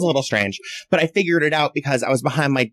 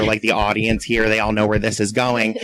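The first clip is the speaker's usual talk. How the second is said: ordinary talk, nothing marked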